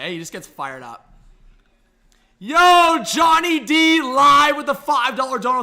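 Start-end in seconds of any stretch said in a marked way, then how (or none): 2.4-5.6 loud, high hype announcer call; hyped shout-out. Real, not acted. Streamer loudly hyping a viewer's donation, high excited call.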